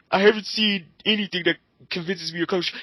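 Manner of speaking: put-on voice, mocking his line; mocking quote. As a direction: parrot him back with contempt.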